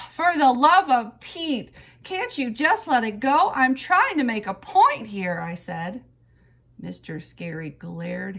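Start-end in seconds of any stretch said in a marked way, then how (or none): 0.1-5.4 loud, high exasperated outburst; fed-up kid pleading to be heard. A narrator's reading. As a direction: burst out in dramatic childish frustration. Exasperated girl after throwing head back.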